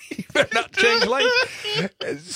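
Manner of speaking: very high squeaky voice through laughter; laughing dog voice. Retold or imitated. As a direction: giggle out a silly high dog voice.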